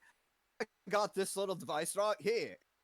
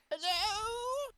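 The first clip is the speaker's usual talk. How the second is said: high quavering granny call; old lady voice. Acted. Character calling into dark room.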